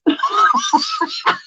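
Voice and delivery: shrieking laugh; high squeal of laughter. Real, not acted. Laughing at lookalike photos with a friend, high shriek.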